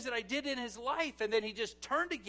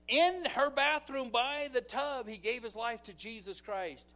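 ordinary delivery

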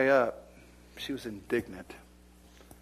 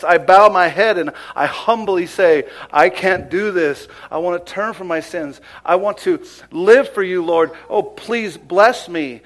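humble prayer; 6 semitones higher, 14 dB louder than usual. Acted. Preacher acting out a sinner's prayer, loud and pleading. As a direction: beg God humbly on your knees.

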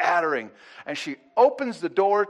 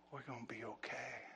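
put-on soft whispered reply from the girls; whispered echo; 3 semitones lower, 22 dB quieter than usual. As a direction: breathe it back quietly. Girls echo mother's reassurance.

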